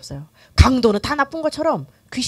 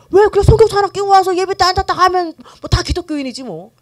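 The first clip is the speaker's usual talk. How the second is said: high, loud mock-dismissive voice; glib self-justification; 7 semitones higher, 6 dB louder than usual. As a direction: brush it off as if it were obvious. Voices nominal churchgoers' glib reasoning.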